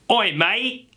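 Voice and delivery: hammed-up high British "oi, mate"; British accent. Shown as a demonstration. Accent teacher showing how to start a sentence, mock British. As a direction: overdo a cheeky British geezer, pitched high. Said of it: "In a British accent".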